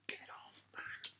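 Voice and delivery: hushed joking whisper; teasing under-the-breath whisper. Real, not acted. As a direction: drop to a whisper for a private jibe. Jokey aside to co-host.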